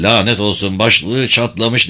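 ordinary delivery, nothing marked

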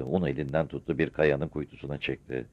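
usual speaking voice

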